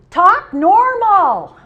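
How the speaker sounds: put-on loud, slow, high demand from a furious little boy; angry child's shout. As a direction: bellow it like a cross three-year-old.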